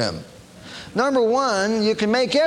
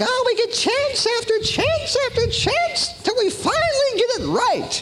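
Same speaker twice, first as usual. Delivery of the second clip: mock believer. Preacher mimicking people who want endless chances, high sing-song. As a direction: parody carefree optimism.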